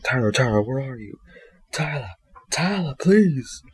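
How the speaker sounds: put-on high, pleading calls for a lost child; distressed calling out. Father searching outside for missing Tyler, high pleading calls.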